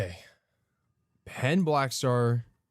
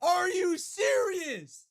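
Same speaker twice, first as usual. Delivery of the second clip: incredulous shout. Real, not acted. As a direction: yell it in disbelief. Disbelief at friend.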